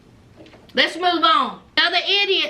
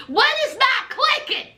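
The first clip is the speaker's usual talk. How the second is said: ordinary talk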